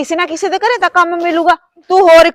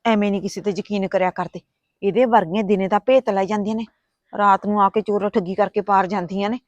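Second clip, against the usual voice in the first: hushed warning; 7 semitones lower, 7 dB quieter than usual. Acted. Warning aside about the maid.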